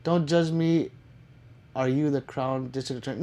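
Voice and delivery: normal talking voice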